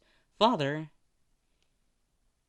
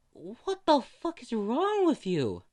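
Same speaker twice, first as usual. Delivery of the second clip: high, loud, slow outraged cry; a nurse stunned by the husband's cold reply, shouting in disbelief; shocked outburst; 11 semitones higher, 4 dB louder, slower than usual. Acted. Nurse shocked at callous husband, high outraged cry. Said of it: "The girl was shocked".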